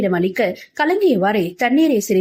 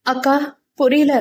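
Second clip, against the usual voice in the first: higher, timid little-sister voice; puzzled younger sister; 4 semitones higher than usual. Acted. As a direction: ask big sister, lost and unsure. Confused younger sister asks elder.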